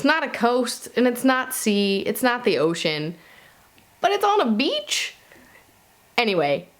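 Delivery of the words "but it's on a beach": incredulous question. Real, not acted. Reviewer puzzling to viewers over the lake setting, incredulous. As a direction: pitch jumps way up on beach, puzzled. Confused that a lake counts as beach.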